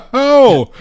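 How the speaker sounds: high burst of laughter; excited laughter. Real, not acted. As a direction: laugh out loud with joy. Thrilled by the Lego Batman news.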